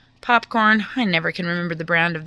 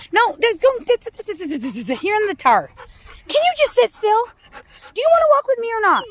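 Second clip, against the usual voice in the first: much higher, louder exasperated scolding; 12 semitones higher, 3 dB louder than usual. Real, not acted. Owner scolding dog walking in the tar, exasperated scolding.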